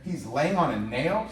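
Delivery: slow, drawn-out incredulous question rising at the end; can't believe what he just heard; kid in disbelief. Retold or imitated. The boy repeats the auntie's words back to her, slow and incredulous. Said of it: "I go".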